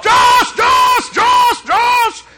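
high, loud frantic screams of the name; screaming a name. Retold or imitated. Father screaming for his missing son in the park, frantic. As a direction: scream for the lost child. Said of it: "I was screaming my head off".

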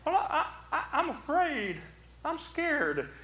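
high, meek and hesitant; hammed-up small scared voice; timid confession. Preacher confessing fear to Jesus, timid high voice. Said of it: "Can you imagine me saying to him".

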